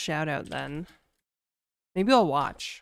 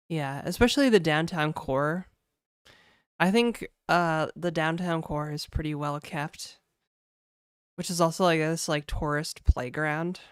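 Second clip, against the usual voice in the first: ordinary talk, nothing marked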